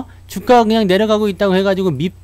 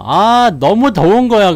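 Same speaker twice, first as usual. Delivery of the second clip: slow, loud groan of heat; sweltering hot; 6 semitones higher, 7 dB louder, slower than usual. Retold or imitated. Boy stuck under a winter blanket, groaning from the heat, slow and loud. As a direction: groan as if stifling under a quilt.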